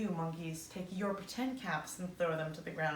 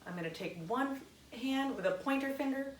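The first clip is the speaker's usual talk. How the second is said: plain reading voice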